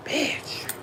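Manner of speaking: breathy whispered swear under the breath; whispered curse. Real, not acted. Talking to her live viewers, a whispered curse. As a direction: hiss the word to yourself in disbelief. Muttered aside on weight gain.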